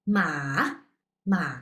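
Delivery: ma dipping low with a turn; third tone. Shown as a demonstration. Teacher showing the third tone turn again. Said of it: "There is turn".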